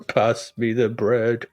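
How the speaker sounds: slow, low mock-singer murmur; subaudible singer. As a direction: draw it out, imitating indistinct lyrics. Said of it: "he sings subaudible lyrics".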